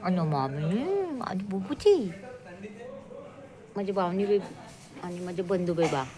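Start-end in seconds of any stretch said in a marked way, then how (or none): none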